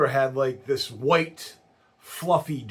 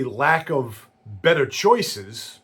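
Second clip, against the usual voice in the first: normal talking voice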